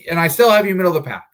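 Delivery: fast, loud and high; buzzing with jittery energy; too much caffeine. Real, not acted. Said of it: "I think the caffeine is, kicking in".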